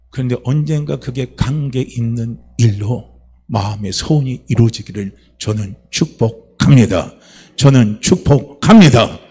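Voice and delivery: slow, measured pronouncement, building to emphatic endings; solemn blessing. Real, not acted. Preacher blesses the congregation's hopes, slow solemn proclamation. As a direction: proclaim the blessing slowly, landing firmly on the last word.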